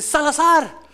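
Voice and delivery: loud stern call of a surname; grito de fraile. Retold or imitated. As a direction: bark the name like a scolding friar. Imita el llamado de regaño. (grito severo llamando)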